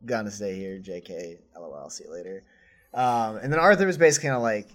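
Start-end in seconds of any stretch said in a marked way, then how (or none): 0.1-2.4 quiet. Retold or imitated. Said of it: "then she's like".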